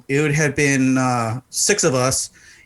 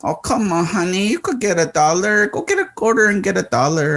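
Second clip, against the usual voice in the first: high, wheedling mock plea; coaxing, pitched well above normal; pleading stripper; 7 semitones higher than usual. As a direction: imitate a stripper begging for just a dollar. Stripper voiced pleading with customer.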